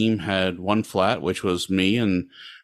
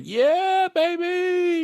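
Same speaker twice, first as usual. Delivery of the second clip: cheering; much slower than usual. Retold or imitated. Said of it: "You were like".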